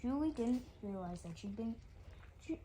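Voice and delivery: usual speaking voice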